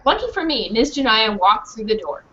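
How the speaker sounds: ordinary delivery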